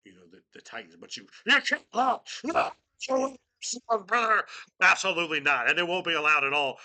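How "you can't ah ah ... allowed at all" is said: loud, high, gruff wrestler promo; Hulk Hogan voice. Retold or imitated. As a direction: yell it like Hulk Hogan, brother. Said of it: "I can do a very good Hogan".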